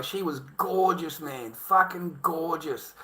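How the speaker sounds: ordinary talk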